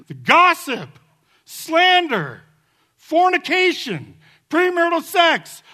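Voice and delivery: high, loud fiery sermon shout; preaching at full force. Acted. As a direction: thunder it from the pulpit.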